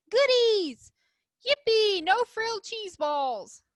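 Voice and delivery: high, loud squeal of delight, slowing at the end; excited mice. A narrator's reading. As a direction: squeal over the treats. Mice cheering in the picture.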